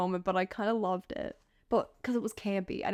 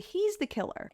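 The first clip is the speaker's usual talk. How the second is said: sudden realization; 10 semitones higher than usual. Retold or imitated. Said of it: "I was like".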